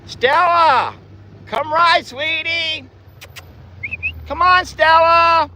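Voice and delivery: high, sing-song voice calling the dog; sweet dog-talk. Real, not acted. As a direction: coax Stella over in a high pet voice.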